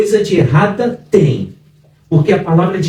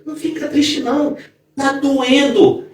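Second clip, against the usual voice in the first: tender comfort; 7 semitones higher, 3 dB quieter than usual. Real, not acted. Comforting the hurting listener.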